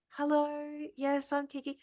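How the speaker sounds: high, soft, drawn-out dog voice; friendly dog voice. Acted. Dog greeting every passer-by, high friendly voice. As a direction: introduce yourself as a sociable dog, high and light.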